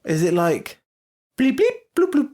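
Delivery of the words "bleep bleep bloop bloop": high, beeping alien noises; robot bleeps. Pretend. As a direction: chirp it like a little robot. Guessing an alien Time Lord name.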